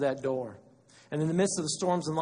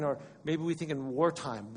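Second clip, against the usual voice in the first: normal talking voice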